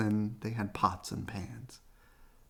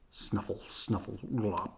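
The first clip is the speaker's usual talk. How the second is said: mudsucker snuffles; 2 semitones lower, 6 dB quieter than usual. Acted. Mudsucker's noises.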